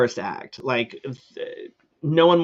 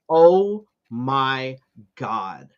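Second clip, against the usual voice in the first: very slow, each word held apart; exasperated disbelief; much slower than usual. Retold or imitated. Reacting to her cackling the entire time, slow exasperation. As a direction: stretch out every word in exasperation.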